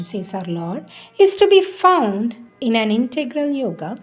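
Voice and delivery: ordinary talk, nothing marked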